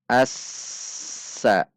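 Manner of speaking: "Assa" dengan desis s ditahan rata; intonasi tetap. Shown as a demonstration. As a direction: alirkan s panjang pada "Assa". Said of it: "dibaca dengan intonasi yang tetap". (sin tasydid "Assa" tak terputus)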